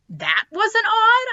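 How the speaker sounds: very high and slow, disbelieving; incredulous. Acted. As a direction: squeak it out in disbelief. Incredulous child voice.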